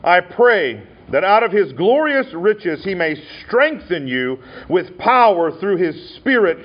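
scripture reading. Acted. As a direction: lift the verses up slowly for the congregation. Reads scripture aloud.

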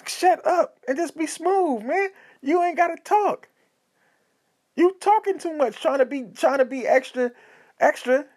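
mock-exasperated, pitch way up; fed up with a friend talking too much; exasperated scolding. Scolds the imagined oversharing friend.

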